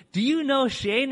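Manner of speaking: asking Joe about Shane, excited and high; excited question. Retold or imitated. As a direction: ask it like you can't believe the coincidence. Excited question about a mutual friend.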